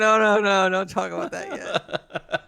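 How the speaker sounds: high, urgent hushing protest; Mariner. Acted. Mariner stopping talk about Q, acted high protest. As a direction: cut them off high, then stretch out the warning. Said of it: "Mariner's going like".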